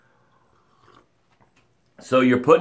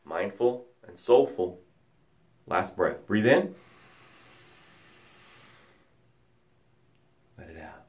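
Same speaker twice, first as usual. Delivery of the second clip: guided breathing; 5 semitones lower, 3 dB quieter than usual. Acted. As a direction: guide the breaths into calm stillness. Leads breathing exercise.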